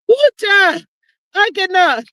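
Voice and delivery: ordinary talk, nothing marked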